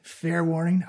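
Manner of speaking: ordinary talk, nothing marked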